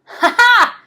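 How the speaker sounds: high, loud gleeful ha ha; mock laugh. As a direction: cackle in triumph. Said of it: "Grouch laughed".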